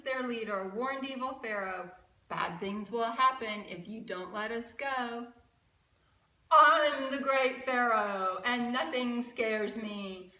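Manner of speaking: lively rhyming storybook read; reading to children. A narrator's reading. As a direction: read it like a picture book for kids. Rhyming picture book read aloud.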